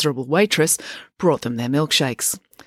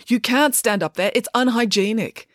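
higher, cross scolding; 7 semitones higher than usual. Acted. Joy to April standing on the table, scolding. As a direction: scold her indignantly.

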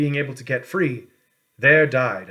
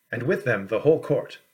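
ordinary delivery

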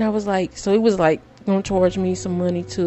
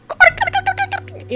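ecstatic squeal; much faster than usual. Retold or imitated. Mom squealing [name]'s name over the cash, much higher. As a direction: squeal the name at the top of your range. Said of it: "And I'm just like".

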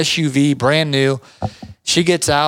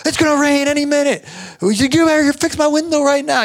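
much higher, stern demand; angry demand; 10 semitones higher than usual. Retold or imitated. Restaurant owner demanding the window be fixed before the rain, voiced much higher. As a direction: order it fixed now. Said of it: "he's like".

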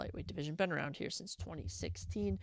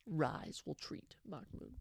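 doubtful whispered aside; whispered doubt about the weight move; 3 semitones lower, 8 dB quieter, slower than usual. Acted. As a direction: hush it like a worry.

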